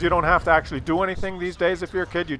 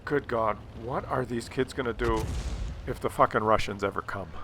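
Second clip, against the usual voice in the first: inner thought; 6 semitones lower, 6 dB quieter than usual. Retold or imitated. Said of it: "all I could think of was".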